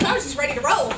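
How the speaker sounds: loud, high laughing apology; laughing at herself. Real, not acted. Pastor laughs at forgetting the children's church, loud laughing. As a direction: laugh it off, embarrassed. Laughs at her own slip.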